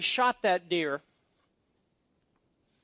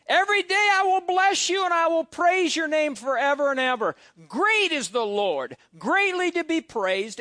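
full-voiced pulpit scripture reading; preached scripture reading; 3 semitones higher than usual. Real, not acted. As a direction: read it out like a preacher lifting the room. Reading psalm at full force.

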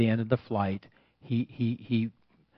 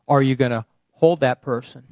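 normal talking voice

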